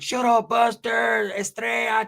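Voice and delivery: slow, drawn-out taunting shout; hammed-up prison-tier heckle; mock tier yell. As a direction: yell it down the tier to shut him up. Southsider yelling down the tier.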